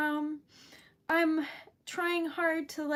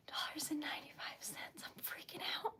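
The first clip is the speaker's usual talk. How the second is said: softer, stunned whisper; hushed freaking out; 16 dB quieter than usual. Real, not acted. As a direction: whisper it in disbelief. Reading donation total, whispers.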